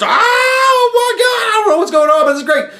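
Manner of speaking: a dog losing its mind with happiness; overexcited dog. Retold or imitated. Dog catching its owner's excitement, high squealing shout.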